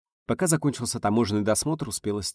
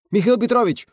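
screamed, much higher and louder; frantic yell; 9 dB louder than usual. Acted. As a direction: yell it out in panic. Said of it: "истошно завопил". (истошный вопль)

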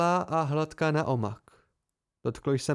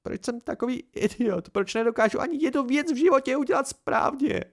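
much higher, rising despair; Dena's despairing self-blame; anguished outburst; 10 semitones higher than usual. A narrator's reading. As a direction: cry out at herself. Dena breaks down in self-blame.

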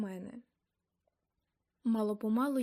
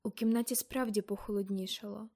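ordinary delivery

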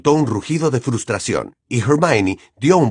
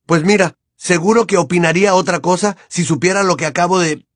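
raised angry retort; 7 semitones higher than usual. Acted. Harry arguing back.

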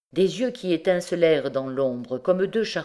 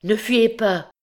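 urgent plea, higher and louder; understated feverish agitation; trembling, halting plea; 6 semitones higher, 5 dB louder, slower than usual. A narrator's reading. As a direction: call out to stop someone fleeing. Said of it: "dont la parole était saccadée et tremblante".

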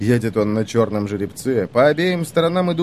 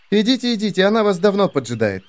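put-on sly high girl voice; 8 semitones higher, faster than usual. Heroine answers slyly.

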